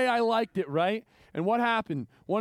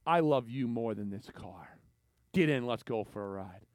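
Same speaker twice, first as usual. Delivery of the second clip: tender uncle; 8 semitones lower, 4 dB quieter than usual. Retold or imitated. Uncle tells kids tenderly.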